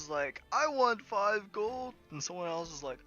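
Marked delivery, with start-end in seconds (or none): 0.5-1.9 high, slow, loud demand; acted complaint, voice pitched up; angry crowd member. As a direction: demand payment like an aggrieved onlooker. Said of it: "angry group starts to form".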